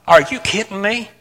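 high, scoffing crowd; mocking disbelief. Crowd scoffing at Noah, light mock disbelief. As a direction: sneer it like a doubtful neighbor. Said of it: "all these people are like".

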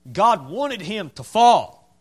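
"fall" drawn out, slow and loud; emphatic repetition. Real, not acted. Preacher driving home God's will to the church, emphatic.

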